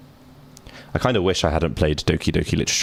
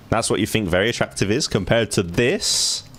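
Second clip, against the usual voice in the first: ordinary talk, nothing marked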